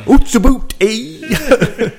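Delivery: exaggerated Canadian "oot and aboot"; high, loud mock Canadian; Canadian accent. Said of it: "my Canadian accent".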